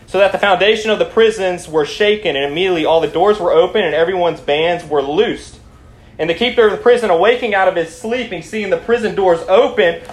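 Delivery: excited sermon at full projection; fired-up preaching. Acted. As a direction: preach it loud and urgent. Preaches at high intensity.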